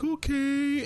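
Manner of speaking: put-on very high, slow whiny kid voice; imitating son. Dad mimicking his son who can't sleep, high and whiny. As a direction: drag it out high like a sleepy crying child. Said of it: "And he's like".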